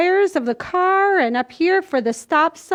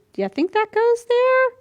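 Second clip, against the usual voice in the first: put-on high, playful wondering aimed at the kids; mock puzzled question; 9 semitones higher than usual. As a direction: pretend not to know where it goes. Teacher feigning doubt over puzzle.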